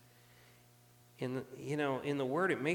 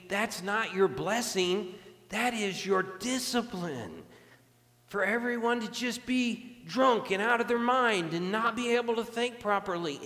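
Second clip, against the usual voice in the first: raised, emphatic preaching voice, pitched high; forceful rebuke; 6 semitones higher, 5 dB louder than usual. Acted. Preacher voices God correcting Judah, in a forceful rebuke. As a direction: drive each phrase home as a loud correction.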